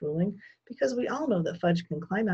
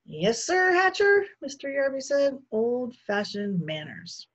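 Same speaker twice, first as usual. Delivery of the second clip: sarcastic; 7 semitones higher, 3 dB louder, slower than usual. Acted. Sarcastic Mr Yarby.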